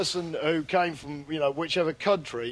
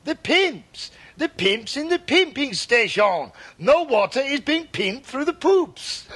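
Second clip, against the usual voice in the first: put-on higher-pitched hammy French accent; cod French accent; 7 semitones higher, slower than usual. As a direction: insist slowly in mangled French-accented English. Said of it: "To which he replied".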